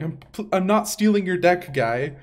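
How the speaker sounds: loudly insist, half joking; mock protest. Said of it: "I was like".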